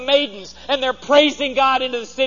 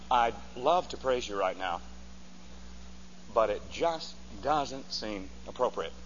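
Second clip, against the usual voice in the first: much lower and softer, a prim excuse; mock timid excuse; 11 semitones lower, 11 dB quieter than usual. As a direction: imitate a half-hearted believer making excuses. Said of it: "ever say to God".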